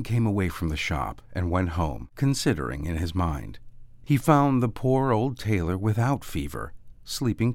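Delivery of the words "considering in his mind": plain reading voice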